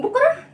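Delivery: "بقرة" as "buqara", pitched high in disbelief; wrong rounded vowel. Shown as a demonstration. Teacher questioning children's mistake, "بقرة" mispronounced as "buqara". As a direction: ask "بقرة" with a rounded "u" after the b. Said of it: "لا، ما بقولش بقرة".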